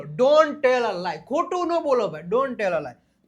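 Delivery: ordinary talk, nothing marked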